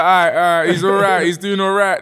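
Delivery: loud, high, warming to it; retold rising approval. Growing approval watching himself back.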